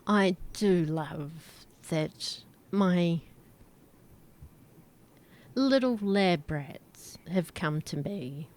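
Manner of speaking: slow, hushed, menacing villain; sinister villain. Acted. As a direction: purr it like a gloating schemer. Hooded figure speaks to players.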